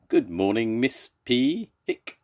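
high greeting cut by a hiccup. Acted. Zebra greets Miss Pig, hiccuping mid-name. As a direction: greet brightly until a hiccup breaks in. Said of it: "Zebra's got hiccups".

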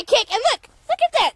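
quick, high excited burst. Real, not acted. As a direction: blurt it out thrilled. Excited about guinea kicking.

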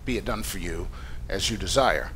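low, soft, tender pronouncement; sincere. Acted. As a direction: turn to her and grant it gently. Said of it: "straight-up sincere conversation".

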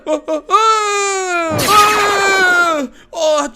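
long, loud, high cries of pain; pained scream. Acted. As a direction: draw out a yell as if transforming painfully. Acted painful transformation scream.

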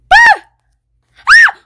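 sudden high loud shriek; startled shriek. Real, not acted. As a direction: shriek when hit. Killed again in game.

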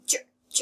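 "จ-" whispered as a short ch puff; fricative J sound. Shown as a demonstration. Teacher drills the ending, whispered friction. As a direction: let จ- out as breath alone. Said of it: "เป็นเสียงเสียดสีออกมา".

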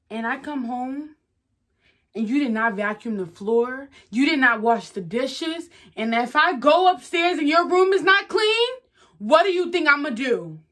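loud, high-pitched angry rant; scolding parent. Acted. Acted parent scolding over chores.

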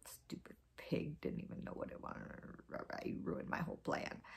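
low, annoyed whispered grumble; grumbling whisper. Acted. Anansi sulking to himself about the pig, low annoyed whisper. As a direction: mutter it under your breath, sulking. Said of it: "And Anansi was like".

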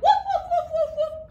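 playful high puppy woofs; puppy barks. Acted. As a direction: yap like a little puppy. Puppy in counting rhyme barks.

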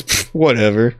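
scoffing brush-off; dismissive pfft. Acted. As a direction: wave it away with a pfft. Mocking Crash in acted quote.

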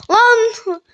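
sudden high, loud cry of surprise; startled yell. Real, not acted. Gamer yelling at the mob, startled and loud.

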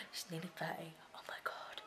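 hushed shocked whisper. Real, not acted. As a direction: whisper it in disbelief. Shocked at his age.

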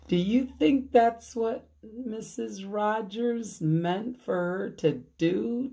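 plain reading voice

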